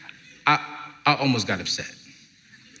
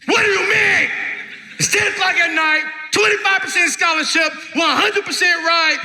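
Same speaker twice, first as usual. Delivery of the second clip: very high, loud exasperated rant; hammed-up furious shouting; losing it; 4 dB louder than usual. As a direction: yell it out, fed up, acting out the outburst. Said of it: "and I lost it".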